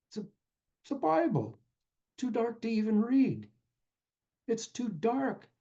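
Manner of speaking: high, pained disbelief; sermon storyteller, stunned by a useless gift; dismayed disbelief. A narrator's reading. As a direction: let the disappointment rise high.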